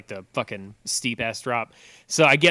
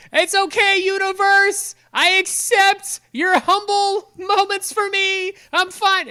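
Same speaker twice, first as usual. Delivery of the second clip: much higher, louder, manic yell; crazy scream; 6 dB louder, slower than usual. Retold or imitated. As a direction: scream it to the sky like a madman. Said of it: "I was screaming out the window like a fucking crazy person".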